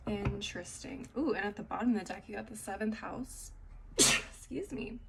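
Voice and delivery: ordinary talk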